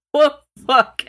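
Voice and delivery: startled, loud outburst; shocked. Real, not acted. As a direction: blurt it out in disbelief. Shocked at cannibal narration.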